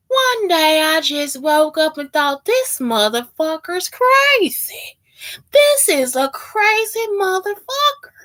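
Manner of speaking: high, drawn-out mock confession; oversharing stranger. Texan stranger answers "how you doing", high mock confession. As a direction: imitate slow, dramatic oversharing. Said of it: "you would just never put two and two together".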